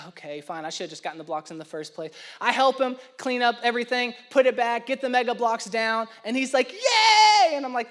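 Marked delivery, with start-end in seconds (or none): 0.1-2.1 soft and fast, giving up under his breath; dad caving to his son over the Mega Bloks, resigned mutter. Retold or imitated. A touch of defeated grumbling. 6.7-7.6 high, loud little yay; happy kid cheer. Retold or imitated. Bright child's yay of delight.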